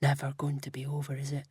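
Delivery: low, soft, quick murmured whisper. A narrator's reading. Brian to Alex in bed at night, whispered. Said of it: "Brian whispered in the green dark".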